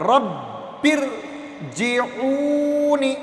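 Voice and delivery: رب ارجعونی with firm doubled sounds, stretched slowly; teacher demonstrating firm but smooth tashdid; firm tashdid, no jerk. Shown as a demonstration. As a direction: press the شدّ smoothly in ارجعونی. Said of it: "اب سختی کے ساتھ".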